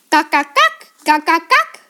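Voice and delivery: staccato clucks jumping up at the end; chicken clucking. A narrator's reading. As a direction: cluck like a hypnotised hen. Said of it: "rief er". (gackernd wie ein Huhn)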